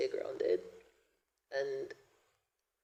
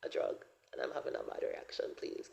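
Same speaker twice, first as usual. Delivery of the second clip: hushed desperate plea; 10 semitones lower, 5 dB quieter than usual. Retold or imitated. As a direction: plead quietly, almost under the breath. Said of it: "I'm just like".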